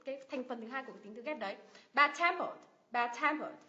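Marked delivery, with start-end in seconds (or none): 1.9-3.6 "Bad-tempered" as bad-TEM-pered; teacher's model of "bad-tempered", weight on TEM; stress on second part. Shown as a demonstration. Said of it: "mình nhấn vào âm tiết thứ hai".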